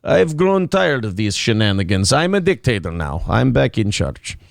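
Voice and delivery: low mock Russian strongman; Putin in Russian accent. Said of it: "you can do a Russian accent".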